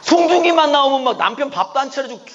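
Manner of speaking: loud, high mock-outraged laughing complaint; laughing indignation. Real, not acted. Preacher complaining his wife watches Song Joong-ki, laughing indignation. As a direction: protest with a laugh.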